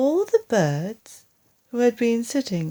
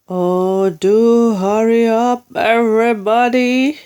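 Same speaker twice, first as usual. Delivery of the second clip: urgent loud plea; 2 semitones higher, 8 dB louder, slower than usual. Acted.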